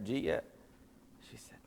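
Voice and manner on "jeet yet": Southern slur. Shown as a demonstration. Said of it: "that Southern word".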